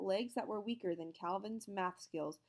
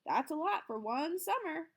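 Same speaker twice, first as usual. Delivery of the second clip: put-on child voice; 10 semitones higher, 4 dB louder than usual. A narrator's reading. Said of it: "said Ashley Z".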